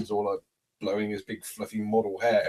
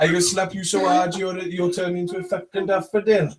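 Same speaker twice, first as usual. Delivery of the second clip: put-on raised, laughing Welsh accent; mock Welsh; 7 semitones higher, 7 dB louder than usual. Trading silly threats in a Welsh off, mock Welsh. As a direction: shout a playful Welsh threat through laughter. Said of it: "A Welsh off".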